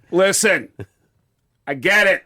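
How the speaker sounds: punchy imitation in separated bursts; Christopher Walken. Comic retelling his brush-off on a job, as Christopher Walken. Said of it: "Christopher Walken just went".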